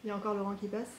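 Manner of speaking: usual speaking voice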